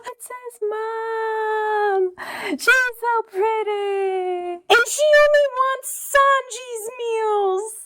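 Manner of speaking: slow, drawn-out sobbing words; hammed-up crying. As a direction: blubber each word in mock grief. Mock-crying over the story.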